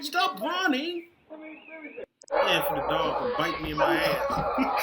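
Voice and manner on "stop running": high, slow mock yell; mock drawn-out shout. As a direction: stretch it out mocking. Mocks the clip slowly.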